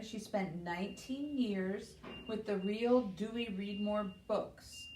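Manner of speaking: plain reading voice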